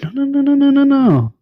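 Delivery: mock the governor waving it all away; mock governor refusal. Governor brushes off concern.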